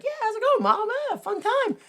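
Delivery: high, chirpy imitation of a kid; super happy. Happy kid coming home to Mom, high mock cheer. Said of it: "he's super happy".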